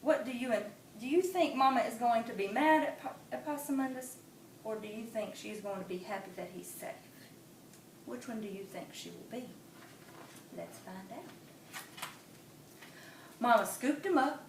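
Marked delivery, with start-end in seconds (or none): 2.2-11.8 soft, questioning teacher voice; asking the children. Acted. Storyteller quizzing young listeners, softly. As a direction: ask the kids gently.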